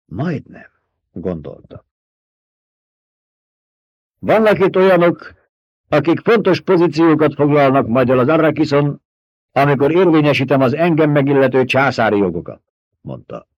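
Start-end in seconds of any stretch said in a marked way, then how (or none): 4.2-12.6 loud, higher, commanding ducal voice; Paul proclaiming. A narrator's reading. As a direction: declare it like a lord before his people. Paul addresses the crowd. (ünnepélyes, parancsoló hercegi hang)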